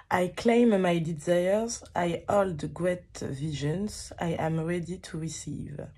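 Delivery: English read slowly with a thick French accent; heavy French accent. Real, not acted. Reading card to viewers in thick French accent. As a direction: sound out each English word slowly, French-style. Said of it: "désolée pour cet accent absolument abominable".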